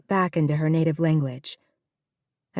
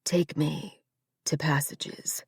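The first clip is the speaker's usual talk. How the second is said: soft, fading to a whisper; a sick man quietly giving in; hushed resignation; 7 dB quieter, slower than usual. Acted. To Gideon on the way out, whispered. Dying man chooses hospice.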